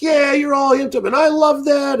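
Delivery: high-pitched, hammed-up giddy cheer; imitation of an over-excited dog owner. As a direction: gush mock-excitedly at an amped-up dog. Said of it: "you're all giddy, like".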